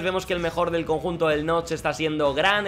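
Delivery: ordinary talk, nothing marked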